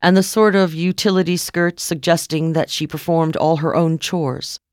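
usual speaking voice

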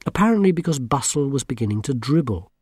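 plain reading voice

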